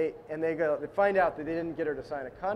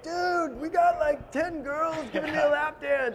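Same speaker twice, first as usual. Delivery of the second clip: excited bro call; 10 semitones higher than usual. Retold or imitated. ADs calling from strip club.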